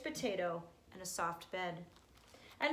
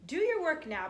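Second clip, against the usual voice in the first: a mother urging her son, a touch pleading; higher, louder motherly plea; 6 semitones higher, 4 dB louder than usual. A narrator's reading. Mother begging her son to work, mildly pleading. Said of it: "pleaded his mother".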